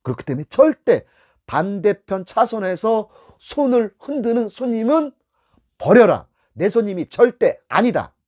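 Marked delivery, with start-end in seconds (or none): 1.5-8.1 higher, slower, louder "버려라"; stern instructor order; firm command. Shown as a demonstration. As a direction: lay down the law. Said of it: "emphatic rule repeated".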